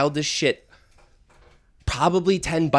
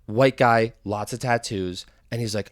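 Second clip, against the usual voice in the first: ordinary talk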